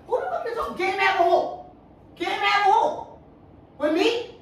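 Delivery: high-pitched, incredulous questioning; indignant challenge. Real, not acted. Partner challenges who he is mad with, indignant. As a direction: throw the question back, offended.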